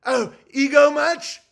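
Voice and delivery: slow high snarky mock voice; sarcastic sneer. Mocking critics' sneer.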